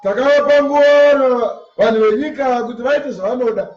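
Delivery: ordinary talk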